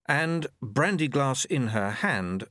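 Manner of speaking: usual speaking voice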